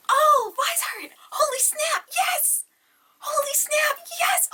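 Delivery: very high, squealed outburst; excited shrieking. Real, not acted. Unboxer discovers her long-wished-for palette, ecstatic high shrieks. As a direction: shriek it with a gasp of delight.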